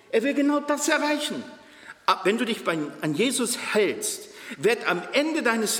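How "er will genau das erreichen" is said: fiery preaching. Acted. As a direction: preach it up hard. Preacher at full force.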